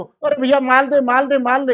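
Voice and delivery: insistent shopkeeper clamouring for goods; urgent demand. Acted. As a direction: badger the supplier for stock, loudly. Acted shopkeeper phoning supplier for stock.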